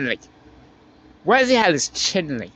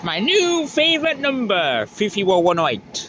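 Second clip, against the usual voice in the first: excited; 5 semitones higher than usual. Real, not acted. Excited trainspotter announcing number.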